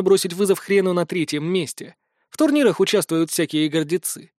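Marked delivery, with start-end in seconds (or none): none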